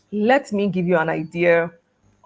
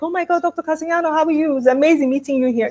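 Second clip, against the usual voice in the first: much higher, thrilled retold hello; delighted surprise at bumping into a mentor; excited greeting; 10 semitones higher than usual. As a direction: greet the old professor as if overjoyed. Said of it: "I'm so excited".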